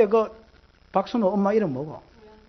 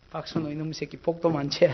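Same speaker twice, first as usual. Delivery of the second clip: angry mutter; 5 semitones lower, 9 dB quieter than usual. Retold or imitated. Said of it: "강대상 빨로 차면서".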